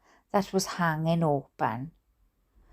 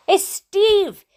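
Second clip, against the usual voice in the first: high, loud shocked shout; 10 semitones higher, 5 dB louder, much slower than usual. Acted. Dad shouting at spotting Steve by the wreck. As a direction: yell his name in disbelief. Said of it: "shouted my dad".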